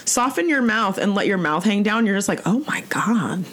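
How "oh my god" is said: breathy awed whisper. Retold or imitated. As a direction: whisper in blissful relief. Blissed-out student in relaxed class.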